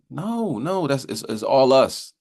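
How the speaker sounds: ordinary delivery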